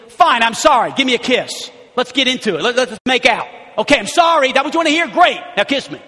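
loud, high impatient sorry; angry apology. Acted. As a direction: toss off a resentful apology. Voicing a husband's fake sorry.

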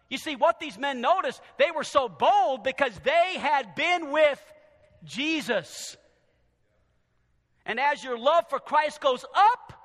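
emphatic preaching. Real, not acted. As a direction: lift the key words for the congregation.

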